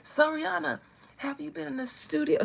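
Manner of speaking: high-pitched mock fan voice; pestering fans. Fans checking on the singer's album, high mock-eager voice. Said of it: "Every time y'all be like".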